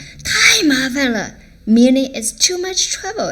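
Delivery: acted outburst at too much trouble; exasperated complaint. Shown as a demonstration. Another scenario, acted complaint.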